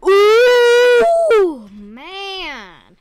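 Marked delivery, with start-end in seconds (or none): 0.1-1.7 long shrieking ooh; loud ooh cry. Real, not acted. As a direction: let out a loud wailing ooh. Loud game reaction.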